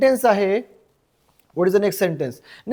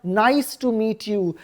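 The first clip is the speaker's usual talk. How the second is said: very slow, softer model phrase; slow teaching model; 5 dB quieter, much slower than usual. Shown as a demonstration. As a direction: stretch out each word for learners. Teacher repeats the model sentence. (शिकवण्यासाठी हळू, सावकाश)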